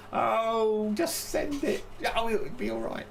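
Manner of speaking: high, breezy, eyes-closed shrug; carefree dismissive voice. Retold or imitated. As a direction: toss it off like nothing matters. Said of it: "close the eyes while you say".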